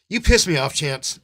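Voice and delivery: really fed up, let it explode; angry outburst. Real, not acted. Angry outburst at entitled viewers.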